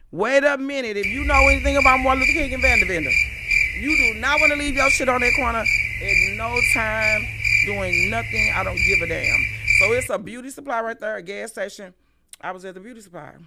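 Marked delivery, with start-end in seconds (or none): 0.1-9.4 loud, insistent heads-up; emphatic warning. Retold or imitated. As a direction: raise your voice and warn them off. Emphatic warning about a dangerous corner.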